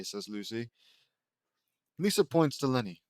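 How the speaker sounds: ordinary delivery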